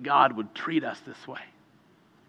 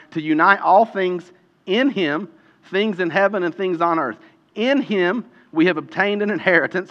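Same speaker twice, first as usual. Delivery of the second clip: higher, emphatic Bible reading; scripture reading; 6 semitones higher, 6 dB louder than usual. Real, not acted. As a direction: proclaim the passage, leaning on each "in him". Reads scripture aloud.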